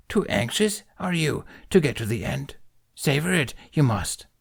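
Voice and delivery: put-on a wise old creature, gently scolding; Yoda voice. Yoda lecturing Ben on his tea, amused. Yoda's voice in story.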